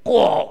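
loud, high raven squawk; short cartoon bird call; raven caw. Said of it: "said the raven".

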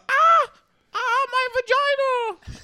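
high, slow, hammed-up screaming; mock scream. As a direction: wail it in over-the-top agony. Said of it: "I'll be like".

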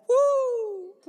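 high whooping cry of praise; joyful woo. Retold or imitated. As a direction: break the hymn with a woo. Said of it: "she would sing".

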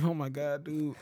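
soft weary groan of words; exasperated. Retold or imitated. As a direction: mutter it, worn out at dawn. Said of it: "I'm like".

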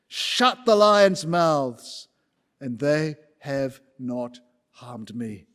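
slow weighty words, GOD stressed; Daniel's solemn reply. Acted. Daniel answering the king, slow and solemn. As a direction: let each word land slowly. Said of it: "to hear words".

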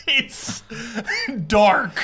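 cracking up while talking; laughing. Real, not acted. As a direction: struggle through laughter to the punchline. Laughing at own dark joke.

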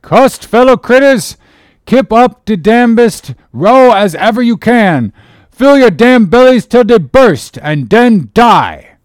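high, loud dialect shouting; shrill cry. A narrator's reading. Cook curses the mob of sharks, shrill shouting. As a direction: cry it out like a benediction hurled at a mob. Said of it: "raised his shrill voice and cried".

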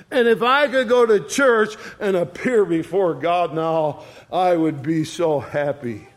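normal talking voice

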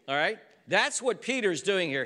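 ordinary delivery

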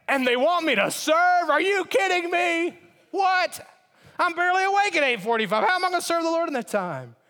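high, loud outraged complaint; indignant churchgoer. Retold or imitated. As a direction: yell it like serving is an insult.

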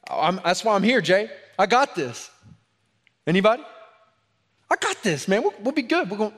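put-on cocky young men shouting we've got this; eager volunteers. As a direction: play the overconfident disciples jumping in.

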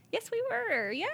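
very high, giddy yes; gushing, high-pitched confirmation; retold delight. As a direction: sing out the answer in a high voice. Retold happy answer to fans.

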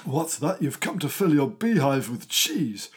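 normal talking voice

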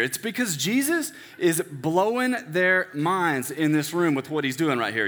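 ordinary delivery

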